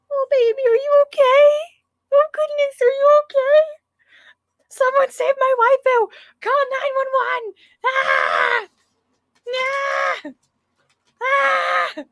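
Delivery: hysterical, loud, high panicking fan; overly dramatic. Acted. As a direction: ham it up like an anime fan. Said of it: "Okay, I'm done being dramatic".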